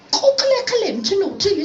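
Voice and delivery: put-on very high, prim English-accented nun mangling the native language; Oxford-accented nun. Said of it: "this is the way she spoke our Nootka native language in her English Oxford accent".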